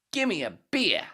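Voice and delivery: high, loud Aussie bloke's voice with a drift toward British; Australian accent. Acted. Head orders a beer from the bartender, loud high Australian accent. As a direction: belt it out as a brash Australian drinker, way up high. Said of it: "Australian accent keep going into British".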